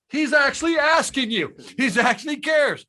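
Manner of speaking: high, pushed inner voice, a mild mock yell; inner voice screaming. His brain screaming as his idol asks how he did, mild mock yell.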